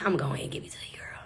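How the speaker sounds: soft whispered promise; whispered consolation. Retold or imitated. Host quietly promising the contestant the money, whispered. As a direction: whisper it kindly to her.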